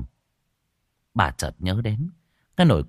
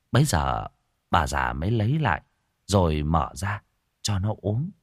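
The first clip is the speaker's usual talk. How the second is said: ordinary delivery, nothing marked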